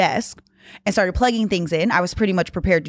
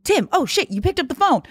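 higher, excited relieved outburst; mock relief; 8 semitones higher than usual. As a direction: gasp with relief that he answered. Relief when friend answers.

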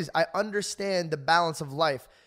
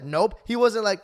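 ordinary delivery

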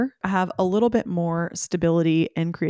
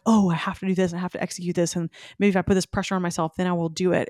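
fast, rushed worrying; mock frantic inner voice; faster than usual. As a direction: gabble it like a stressed to-do list. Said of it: "addicted to the drama a little of like".

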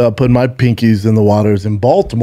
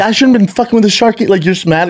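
mock angry; 8 semitones higher than usual.

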